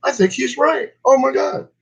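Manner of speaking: hammed-up surprise, pitch climbing; mock amazement. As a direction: play the stoned friend suddenly seeing the light. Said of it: "talk to somebody else and go".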